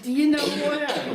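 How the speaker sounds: put-on high, loud old woman's challenge; old lady challenging. Old lady daring the devil, high and loud. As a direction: pitch it up and throw down the challenge.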